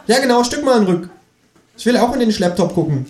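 high, fast cheeky kangaroo. Acted. Kangaroo confirms the twisted phrase, high and quick.

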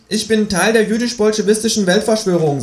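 high creature voice, matter-of-fact; Känguru. A narrator's reading. Kangaroo drops a confession on companion, high blithe voice. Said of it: "sagt es".

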